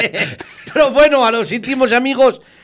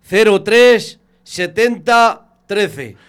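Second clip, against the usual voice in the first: slow number dictation; much slower than usual. Real, not acted. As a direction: spell out each number slowly. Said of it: "Venga, va". (dictando despacio el número)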